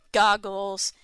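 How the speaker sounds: normal talking voice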